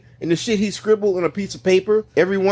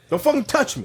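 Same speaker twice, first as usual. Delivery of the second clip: raised, pitched-up angry snap; angry warning; 6 semitones higher than usual. Acted. Man snapping at the friend grabbing him, angry raised voice. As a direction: warn him off for the last time.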